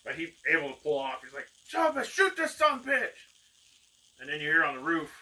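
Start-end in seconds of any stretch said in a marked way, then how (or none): 1.7-3.2 loud, high-pitched angry yell; frantic shouted order. Acted. Rider pulls free and orders his men to shoot, furious shout. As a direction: holler the order in a fury.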